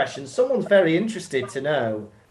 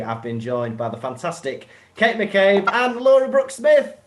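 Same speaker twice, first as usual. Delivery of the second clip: ordinary talk, nothing marked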